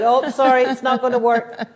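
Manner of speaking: high, loud, laughing through the no; laughing refusal. Retold or imitated. Principal laughing as he refuses the students' marijuana club. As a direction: turn them down while chuckling.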